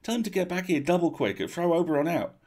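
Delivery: high excited insistence; Spindle. A narrator's reading. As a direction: demand it in a high eager voice. Spindle voiced in story.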